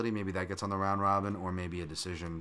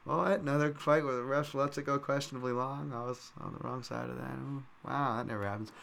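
higher-pitched sarcastic grumble; mock surprise; 5 semitones higher than usual. Gambler griping about refs letting fights run, mock surprise. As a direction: put on a sardonic, faux-amazed voice.

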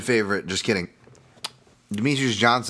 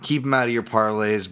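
ordinary talk

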